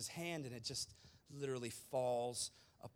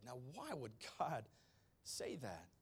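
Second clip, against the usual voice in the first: normal talking voice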